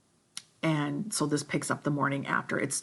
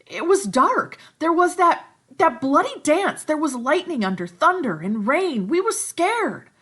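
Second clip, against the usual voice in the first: put-on much higher and louder frantic boy; agitated Piggy; 9 semitones higher, 10 dB louder than usual. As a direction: defend yourself frantically, high.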